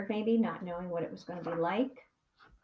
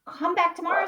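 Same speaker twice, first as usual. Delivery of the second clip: teacher's voice; 8 semitones higher, 5 dB louder than usual. A narrator's reading. Teacher voiced in read-aloud.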